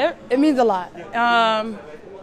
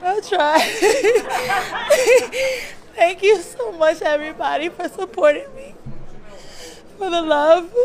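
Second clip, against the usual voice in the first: higher-pitched, laughing through tears; tearful thanks; 5 semitones higher than usual. Real, not acted. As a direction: give heartfelt thanks while choking up with happy tears. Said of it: "I'm sorry".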